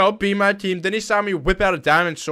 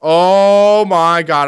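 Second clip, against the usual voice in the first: drawn-out exclaim; 2 semitones higher, 9 dB louder, much slower than usual. Real, not acted. Reacting in game.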